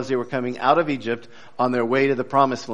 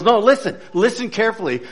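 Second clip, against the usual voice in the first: higher, urgent plea to listen; eager insistence; 7 semitones higher, faster than usual. Acted. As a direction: press him to hear it too.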